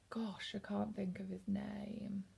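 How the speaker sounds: whispered aside, forgetting a name; a real under-the-breath whisper while trying to remember; muttered to self. Real, not acted. Searching memory for author's name.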